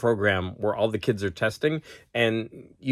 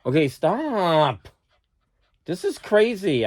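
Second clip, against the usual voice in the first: telling off the dog; 6 semitones higher, slower than usual. Real, not acted.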